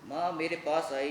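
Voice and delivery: ordinary delivery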